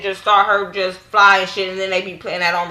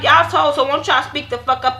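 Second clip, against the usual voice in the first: irritated callout; 7 semitones higher than usual. Retold or imitated. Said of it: "I'm sitting there like".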